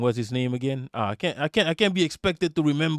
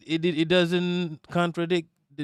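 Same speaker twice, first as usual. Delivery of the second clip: ordinary talk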